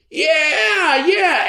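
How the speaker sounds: high, loud yells; crowd agreeing. Acted. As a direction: yell agreement. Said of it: "everyone agreed".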